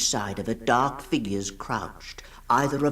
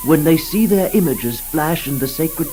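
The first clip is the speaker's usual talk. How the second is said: a rapt lover pleading, growing louder and more passionate; Karnavutra's ardent plea; 4 dB louder than usual. Acted. Karnavutra's love speech to Vava Nyria.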